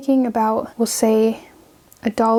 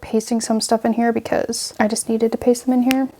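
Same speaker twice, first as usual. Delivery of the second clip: ordinary talk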